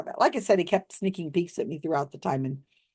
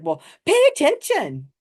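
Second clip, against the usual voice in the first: higher, louder snippy pay attention; impatient scolding; 9 semitones higher, 5 dB louder than usual. Retold or imitated. Psychic scolds an audience member, loud and high. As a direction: snap at her to keep up. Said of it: "And he's like".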